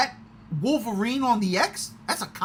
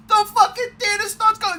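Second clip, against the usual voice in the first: high and louder, laughing through it; giddy laughing retell; laughing excitedly; 7 dB louder than usual. Real, not acted. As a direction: laugh while you tell it. Laughing about the crowd reaction.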